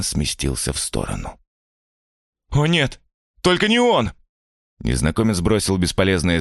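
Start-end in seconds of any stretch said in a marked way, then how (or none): 2.5-4.1 high, loud panic; panicked. Acted. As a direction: cry out in terror. Stranger panics at roar.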